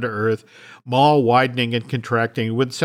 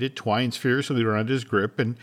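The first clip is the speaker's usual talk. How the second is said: ordinary talk, nothing marked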